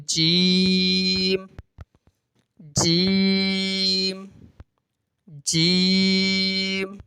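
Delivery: "جیم" with the ee held very long on a level tone; steady drawn-out recitation of "جیم"; five-count elongation. Shown as a demonstration. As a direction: stretch each "جیم" slowly to a hummed m. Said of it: "جب جیم پڑھیں گے".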